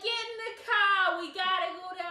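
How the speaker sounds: loud, high bad Boston accent. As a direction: belt it in a hammy Boston accent. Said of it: "she puts on the worst Boston accent you can imagine".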